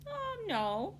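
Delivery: put-on high cheerful little girl; a chirpy young girl answering.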